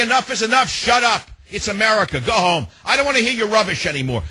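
high, rising to a shout at the end; defiant shout. Pretend. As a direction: bark it back at them, fed up. Tells listeners what to shout back.

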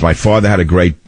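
ordinary delivery

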